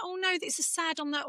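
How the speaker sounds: high, soft mock upset; mock tearful. Mum explains a sad TV show to her son, mock tearful. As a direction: imitate yourself welling up, pitched high. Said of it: "I do grizzle a little bit".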